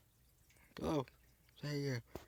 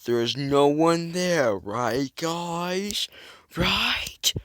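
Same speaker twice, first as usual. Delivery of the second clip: loud, slow, slightly raised put-on voice; lightly strange put-on voice; odd put-on voice; 4 semitones higher, 4 dB louder, much slower than usual. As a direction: talk in a silly put-on voice. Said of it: "why I'm talking like this".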